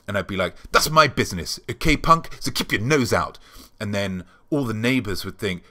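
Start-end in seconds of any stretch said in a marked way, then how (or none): 0.7-3.4 mock tough-guy snarl, louder; evasive but aggressive. To a nosy neighbour, mock aggressive and evasive. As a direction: play a shady tough telling off a neighbour. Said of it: "a little bit aggressive".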